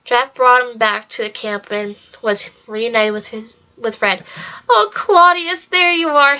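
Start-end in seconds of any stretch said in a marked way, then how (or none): 4.7-6.4 high, bright relieved greeting; happy relief. A narrator's reading. Mother relieved to find Claudius, happy. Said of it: "she said happily".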